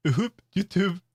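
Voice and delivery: normal talking voice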